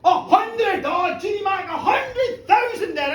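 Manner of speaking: high opening shout into bid patter; excited auctioneer. Acted. Mock auction with audience.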